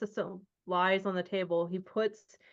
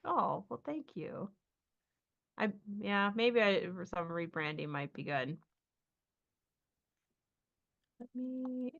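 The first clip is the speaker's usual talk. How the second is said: softer, embarrassed; 7 dB quieter than usual. Acted. Assistant answers, hurt and embarrassed.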